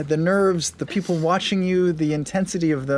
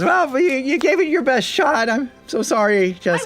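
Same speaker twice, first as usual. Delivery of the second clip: higher-pitched announcer consoling a contestant; announcer Luke; 7 semitones higher, 3 dB louder than usual. Acted. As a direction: put on Luke's raised announcing voice. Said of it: "Luke announces".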